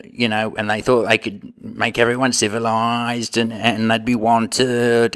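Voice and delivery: put-on Australian accent, "mate" on every line; Aussie bloke voice. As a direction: play the cheeky Australian, pitch climbing as he gets worked up. Comic Aussie character, "mate" throughout.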